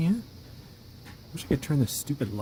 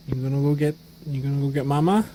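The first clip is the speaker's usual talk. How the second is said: ordinary talk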